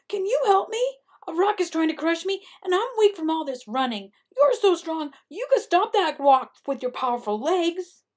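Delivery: high, loud, panicked begging; pleading Coyote. A narrator's reading. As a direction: beg urgently for rescue. Panicked Coyote begs sheep for help.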